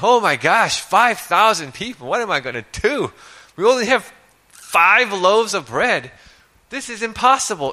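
high, loud mock panic; hammed-up frantic panic. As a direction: play a frantic man, ham it up. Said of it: "can you imagine Jesus becoming frantic".

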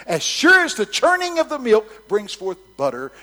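loud high preaching; preaching at full force. Acted. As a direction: proclaim the verse. Quoting proverb in preaching.

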